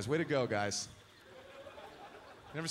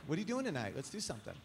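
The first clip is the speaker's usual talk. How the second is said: put-on softer, coaxing girlfriend; girlfriend asking; 7 dB quieter, faster than usual. Girlfriend asking what he's doing tonight, softly coaxing. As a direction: ask him out quietly, coaxing. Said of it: "she was like".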